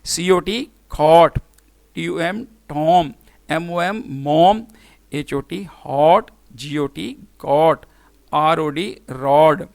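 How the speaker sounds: slowly spell, then say cot with an open "aw" vowel; letters first, then a drawn-out mom with open O; short O demo. Shown as a demonstration. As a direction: model short O in hot, got, rod, slowly. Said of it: "ओ की short vowel sound".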